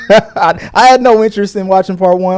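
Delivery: ordinary delivery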